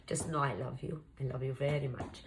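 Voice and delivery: soft, low, playful goodbye; mock tender farewell. Cook to viewers in case the mixer kills her, mock tender. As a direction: drop your voice for pretend last words.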